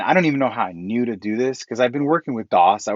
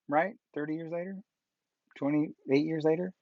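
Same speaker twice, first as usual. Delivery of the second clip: ordinary delivery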